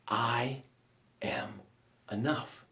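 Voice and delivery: very soft, drawn-out whisper of I am enough; hushed. Acted. Coach breathing out the affirmation very softly.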